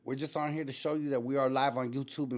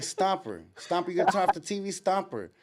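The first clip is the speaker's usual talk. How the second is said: raised voice calling a sleeper; retold attempts to rouse him, higher pitched; 7 semitones higher, 3 dB louder than usual. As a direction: call his name to get through to him.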